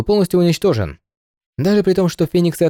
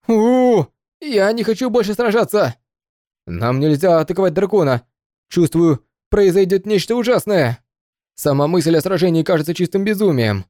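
terrified, voice pitched up in panic; panicked soldiers; 6 semitones higher than usual. Acted. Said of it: "Воины впали в панику".

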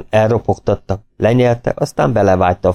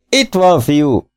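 calling out; 7 semitones higher, much slower than usual. A narrator's reading. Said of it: "kiáltotta végül".

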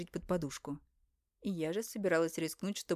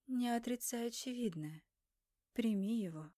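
higher, softer ghostly voice; a distant divine voice in her head; otherworldly voice; 5 semitones higher, 3 dB quieter than usual. Acted. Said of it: "раздался второй голос".

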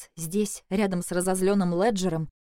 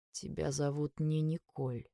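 slow, soft, saddened statement; sad realization; 7 dB quieter, slower than usual. A narrator's reading. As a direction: let it sink in sadly, unhurried. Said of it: "сказал он с каким-то печальным осознанием". (с печальным осознанием)

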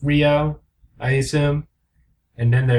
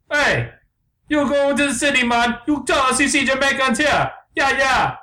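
very high mock Jamaican; hammed-up Jamaican bird, pitched way up; unconvincing Jamaican accent; 10 semitones higher than usual. Jamaican bird answers the complainers, high mock Jamaican accent. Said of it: "these bloody Jamaicans".

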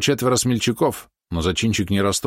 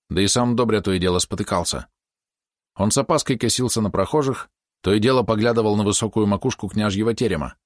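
plain reading voice

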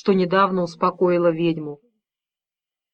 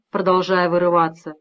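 ordinary delivery, nothing marked

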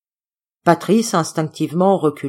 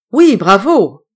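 much louder, proud bravo; an uncle bursting with proud joy; choking with pride; 3 semitones higher, 8 dB louder, slower than usual. A narrator's reading. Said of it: "Râla Coriolis".

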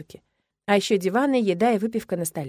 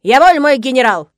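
loud mock-military salute; clownish; 3 semitones higher, 10 dB louder than usual. A narrator's reading. Timur clowns back at his commanding brother, loud and silly. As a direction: belt it out like a goofy soldier. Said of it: "дурашливо отвечает Тимур".

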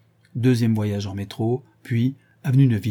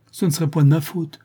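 higher, louder protest; a mildly heated, raised protest; lively exclamation; 5 semitones higher, 4 dB louder than usual. Acted. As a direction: cry out quickly in self-defence. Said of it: "s'écria vivement le professeur".

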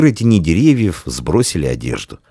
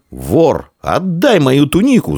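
accusing cry rising high; angry shout; 11 semitones higher, 4 dB louder, slower than usual. A narrator's reading. Boy to the thief wearing his tunic, angry shouting. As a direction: shout the accusation, climbing in pitch. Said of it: "закричал мальчик".

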